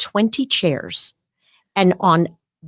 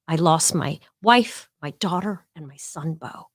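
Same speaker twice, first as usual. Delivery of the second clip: slow, softer and softer to a near silence; fading grief; 3 semitones lower, 3 dB quieter than usual. Retold or imitated. Recalling the President's lost wife and children, fading into grief. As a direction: let each loss sink quieter.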